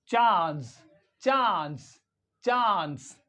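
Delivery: CHANCE as 'chahns', long open vowel; British long A. Shown as a demonstration. Teacher drilling British English, long-ah CHANCE. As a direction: say chance with the A of father. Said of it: "The same way we pronounce".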